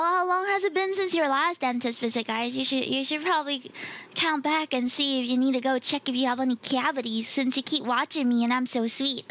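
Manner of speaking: normal talking voice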